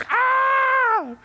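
high sharp cry of a burnt hand; hammed-up pained shriek; mock yelp of pain. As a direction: yelp as if the stove just burnt you. Acted burn, cry shout.

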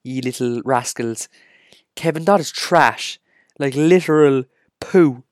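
slow, loud, high put-on rant voice; slow exaggerated rascal-scolding voice; mock rant. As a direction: ham up a scolding rant at the fans. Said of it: "And I'm like".

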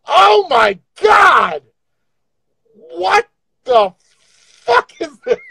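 high, stretched shocked cry; shocked freakout. Real, not acted. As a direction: freak out at your own face. Freaking out at filters.

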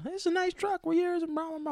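squeaky chatterbox neighbor; chatty lady. Retold or imitated. Neighbor lady chatting in the truck, high squeaky mock voice. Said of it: "she's like".

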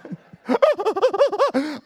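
high, loud stuttering sobs; hammed-up juddering crying noise; mock sobbing. Preacher re-enacts sobbing in the barber's, hammed-up. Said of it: "I am sobbing".

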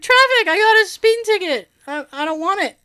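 high, loud flustered blurt; high loud panicky answer with a laugh; nervous kid. Retold or imitated. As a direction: blurt it out like a nervous sixth grader. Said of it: "Because I'm nervous".

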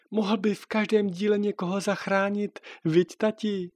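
a daughter coaxing her dad; child's voice. Acted. Daughter Natálka asks father.

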